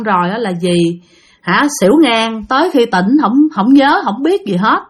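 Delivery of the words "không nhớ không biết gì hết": plain reading voice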